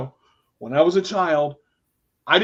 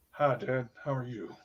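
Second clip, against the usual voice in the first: low, very soft, dutiful hello; mock teenage reluctance, voice sunk low; grudging greeting; 5 semitones lower, 10 dB quieter than usual. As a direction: greet him quietly with no enthusiasm. Reluctant greeting to estranged father.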